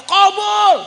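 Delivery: high loud call; comic loud answer; punchline call. Real, not acted. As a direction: bang out the answer. Punchline granted.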